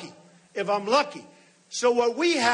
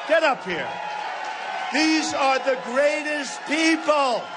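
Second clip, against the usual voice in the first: calling out; 5 semitones higher, slower than usual. Real, not acted. Calls police up, praises them.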